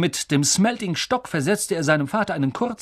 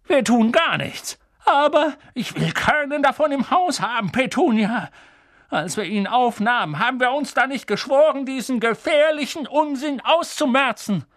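high-pitched, insistent tirade; agitated vehemence; 10 semitones higher than usual. Acted. Uncle reminding his wife of their vow, agitated and high. Uncle vows to stamp out nonsense.

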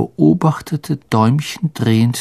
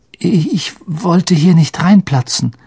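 higher, stumbling apology; trembling; 6 semitones higher, 3 dB louder than usual. Acted. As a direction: trip nervously over the first word. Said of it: "sagte er zitternd". (zitternd und stockend)

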